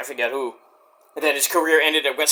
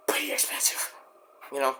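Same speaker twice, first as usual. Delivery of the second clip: whispered aside; 3 semitones lower, 12 dB quieter than usual. Real, not acted. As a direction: drop into a whisper. Same whispered aside on cost.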